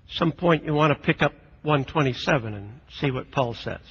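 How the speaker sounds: ordinary talk, nothing marked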